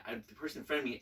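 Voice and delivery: ordinary talk, nothing marked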